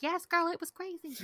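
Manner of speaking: high, put-on sassy dog voice; playful high-pitched aside as the dog; dog chiming in. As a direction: chime in high as the talking dog. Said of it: "the dog's outside chiming in".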